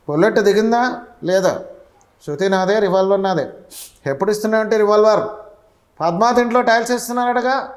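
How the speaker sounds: film hero's cool one-liners, pitched high; Mahesh Babu impression. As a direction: fire the famous lines casually. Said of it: "ఇలా అలా మహేష్ బాబు గారు".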